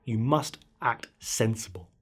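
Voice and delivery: plain reading voice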